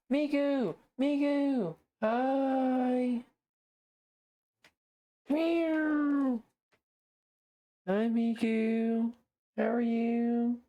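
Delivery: high sing-song pet talk; talking to a cat. Real, not acted. Greeting her cat Migu.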